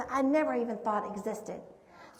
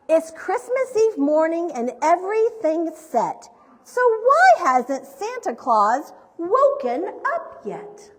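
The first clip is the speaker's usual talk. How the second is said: animated picture-book narration, pitched up; story reading to children; 5 semitones higher, 7 dB louder, slower than usual. A narrator's reading. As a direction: read aloud to little listeners with big swings of pitch.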